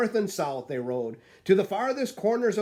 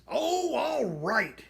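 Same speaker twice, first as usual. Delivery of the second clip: barked; 9 semitones higher, much slower than usual. A narrator's reading. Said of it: "he barked".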